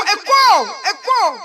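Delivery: calling out an echo. Pretend. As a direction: throw the word out to make it echo. (lancer « écho » d'une voix forte)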